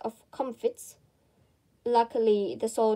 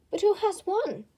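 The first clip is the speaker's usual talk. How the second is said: usual speaking voice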